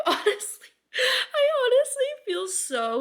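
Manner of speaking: high, slow, teary squeak; choked up. Real, not acted. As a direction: squeeze it out as if about to cry. Overcome at feeling validated by list.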